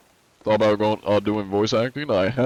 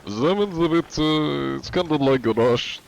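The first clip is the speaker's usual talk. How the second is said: higher, shaky attempt at a German accent; 6 semitones higher than usual. Shown as a demonstration. Streamer attempting a requested German accent. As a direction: try German, drifting toward Russian. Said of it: "Do German accent?".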